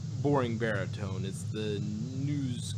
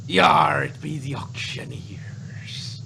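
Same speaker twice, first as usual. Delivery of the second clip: loud "Yar", then a slow unvoiced pirate growl; mock pirate voice, slow and whispered; 4 semitones higher, 5 dB louder, much slower than usual. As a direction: ham it up as a pirate announcing the villains. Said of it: "It sounds like a pirate organization".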